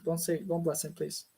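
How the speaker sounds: ordinary talk, nothing marked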